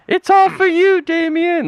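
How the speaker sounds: very high-pitched mock scream; piercing falsetto imitation of a horror-film cry; imitated scream. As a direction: call it out like the nanny from the rooftop. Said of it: "screams out".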